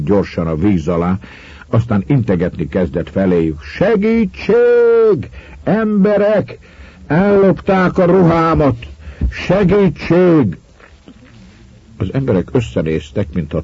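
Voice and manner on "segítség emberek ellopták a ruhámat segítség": high, drawn-out cries; calling for help. A narrator's reading. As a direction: shout for help to people far off. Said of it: "A kiabálásra megálltak". (elnyújtott segélykiáltás)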